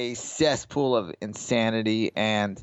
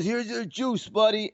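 put-on higher, goofy drunk-guy voice for the dealer; drunk gambler voice; 7 semitones higher than usual. Mocking the drunk poker player tipping his dealer, goofy higher voice.

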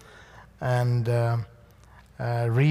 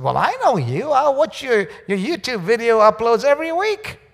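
higher, louder eager fan voice; excited lady; 9 semitones higher, 6 dB louder than usual. Retold or imitated. South African lady greeting the speaker in the hallway, higher and louder eager fan voice. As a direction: gush like a fan meeting the speaker.

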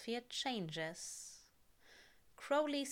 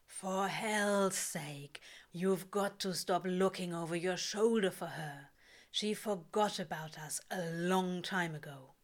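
lightly voiced Crowley. A narrator's reading.

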